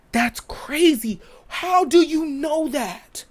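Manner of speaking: breathy high whisper of amazement; awed whisper. Real, not acted. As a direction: whisper it in disbelief. Amazed aside about the songwriter.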